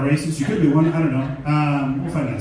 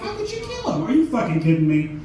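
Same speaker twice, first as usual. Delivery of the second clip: a much higher mock shriek on the first line; mock outrage; 8 semitones higher, 4 dB louder than usual. Comic shrieks mock outrage to mimic upset fans. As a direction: squeal it like a scandalized fan. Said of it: "People are like".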